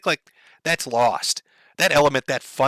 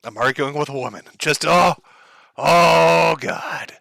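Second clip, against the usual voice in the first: normal talking voice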